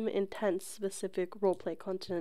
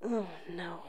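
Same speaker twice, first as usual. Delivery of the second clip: whisper; 6 dB quieter than usual. Real, not acted. Reacting during ASMR sounds.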